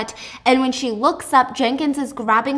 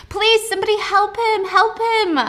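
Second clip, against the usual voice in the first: much higher, louder desperate cry for help; pleading scream; 10 semitones higher, 4 dB louder than usual. Retold or imitated. Juliet begs the guards to help Jenkins, high pleading scream. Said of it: "she's screaming".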